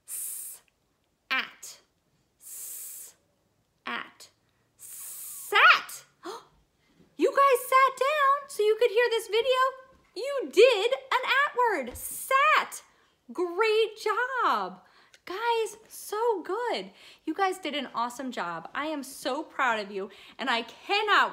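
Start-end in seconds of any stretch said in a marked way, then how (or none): 0.1-0.6 "S" as a long unvoiced hissing sss; drawn-out s like a snake. Shown as a demonstration. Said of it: "sounds like a snake". 2.4-3.1 "S" as a long unvoiced hissing sss; drawn-out s like a snake. Shown as a demonstration. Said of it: "sounds like a snake". 4.8-16.9 high, loud and thrilled; excited. Acted. Excited reveal of sat.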